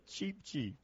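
lightly voiced baby chick; soft mock chick cheeping; cartoon bird voice. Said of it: "All you baby chicks say".

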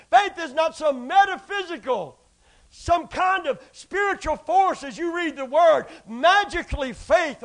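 loud, high preaching cry; fiery preaching. Acted. Preacher shouting to the church about faith.

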